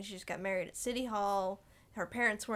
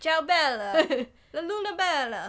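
put-on old Italian man; 6 semitones higher, 7 dB louder than usual. Friend imitating the grandpa's Italian about the moon. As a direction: croon it like a sweet Italian grandpa. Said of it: "sweetest little old Italian man".

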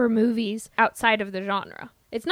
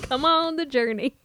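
exaggerated Midwestern vowels on journey; Midwestern accent; 2 semitones higher than usual. Shown as a demonstration. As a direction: ham up the Midwestern twang. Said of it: "with my Midwestern accent".

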